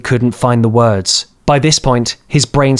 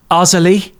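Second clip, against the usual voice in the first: put-on nervous boy; 6 semitones higher than usual. A narrator's reading. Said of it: "he asked nervously".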